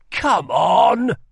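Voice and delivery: loud, high urging call; eager shout. Acted. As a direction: coax his friend out for fun. Rye urging Kel to go.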